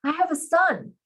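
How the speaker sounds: high-pitched elated announcement; joyful exclamation. A narrator's reading. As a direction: proclaim it with soaring joy. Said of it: "The joy on his face".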